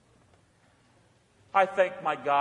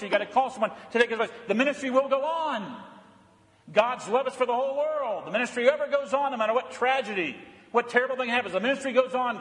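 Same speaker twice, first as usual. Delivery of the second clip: high-pitched, driving sermon delivery; fervent preaching; 7 semitones higher, 4 dB louder than usual. Real, not acted. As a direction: preach it with urgency, pitch lifted. Preaches with rising fervour.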